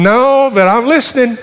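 high, laughing through the words; laughing reply. Retold or imitated. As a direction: laugh while answering. Retold chat with father-in-law.

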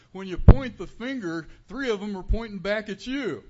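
a loud, high imitation of an old preacher's Southern twang. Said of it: "in his old Southern twang".

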